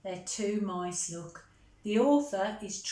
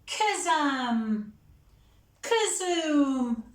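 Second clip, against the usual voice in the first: louder, higher, building magic words; spell calls; 5 semitones higher, 4 dB louder, much slower than usual. Acted. Monty trying the spell again, growing louder. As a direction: wave the wand and call it grandly.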